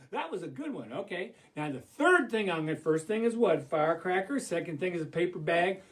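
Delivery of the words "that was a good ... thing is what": ordinary delivery, nothing marked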